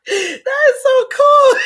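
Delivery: high, loud, slow and laughing; laughing delight. Real, not acted. Host reacting to viewers' comments, laughing delight. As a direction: draw it out through real laughter.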